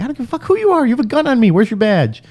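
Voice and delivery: high-pitched indignant challenge; retold outraged defiance. Driver challenging an off-duty deputy's gun, outraged. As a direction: push it high, incredulous and combative.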